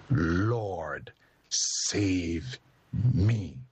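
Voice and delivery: low, sinking plea to the Lord; a hint of a drowning man begging; Peter's plea. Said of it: "Isn't that a powerful prayer?".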